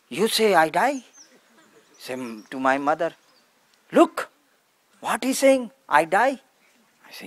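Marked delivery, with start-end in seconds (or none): 0.1-1.0 imitating an indignant father, higher and louder; angry father. Father challenging his child who told him to die, indignant. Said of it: "Before, very fighting and angry". 3.9-6.4 imitating an outraged father, high-pitched and loud; angry father. Father complaining to mother about his child, indignant. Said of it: "Before, very fighting and angry".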